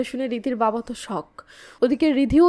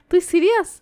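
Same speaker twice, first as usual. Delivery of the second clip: higher, louder amazed question; surprised; 5 semitones higher, 3 dB louder than usual. A narrator's reading. Husband hearing the pregnancy news, amazed. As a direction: ask it in sheer amazement. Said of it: "Kevin অবাক হয়ে বলে".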